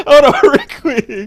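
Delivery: high, loud words through helpless laughter; laughing hard. Real, not acted. Host asks caller to hold on, laughing hard. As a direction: squeeze the words out while cracking up.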